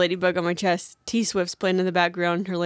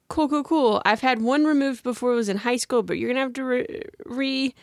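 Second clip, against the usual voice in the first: nervous high chatter; 6 semitones higher than usual. Retold or imitated.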